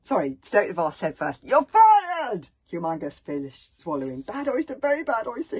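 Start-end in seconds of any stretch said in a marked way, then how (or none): 1.4-2.5 high, very loud dismissal; furious shout. A narrator's reading. Stoic sacks the bodyguard over an oyster, shouting. 4.2-5.6 loud, high-pitched gulped words; gulped warning. A narrator's reading. Bodyguard explains the swallowed oyster, gulping. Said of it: "he gulped".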